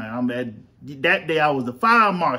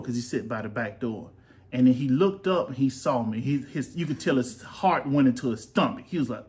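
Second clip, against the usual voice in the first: ordinary delivery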